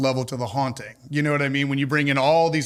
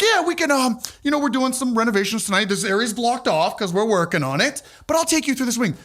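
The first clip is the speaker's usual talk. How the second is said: put-on casual, pitched higher; super casual; 7 semitones higher than usual. Owner stalls the officer with an excuse, higher put-on casual voice. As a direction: play it cool to cover something up. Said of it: "being super Ocean's Eleven about it, casual".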